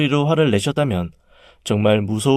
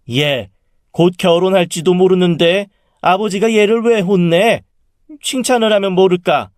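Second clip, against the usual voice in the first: high, heated rebuke; angry mother; 8 semitones higher, 4 dB louder than usual. A narrator's reading. Mother defends her daughter to aunt, angrily. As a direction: argue back crossly in a raised pitch. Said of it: "갑자기 엄마가 화를 내시더라고요".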